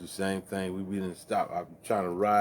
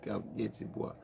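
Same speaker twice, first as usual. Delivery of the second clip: ordinary talk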